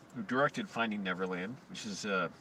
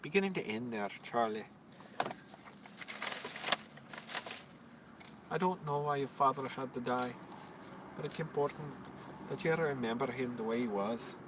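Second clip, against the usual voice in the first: put-on softer, fuller Irish accent, a gentle film line; quiet, tender Irish accent delivering a movie line; 5 dB quieter than usual. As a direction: play a soft-spoken Irish father figure. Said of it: "Since when can I do an Irish accent? That was decent".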